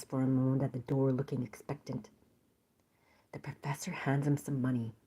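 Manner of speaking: hushed whisper; whispering the twist. Acted. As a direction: drop to a secret whisper. Story read in whisper.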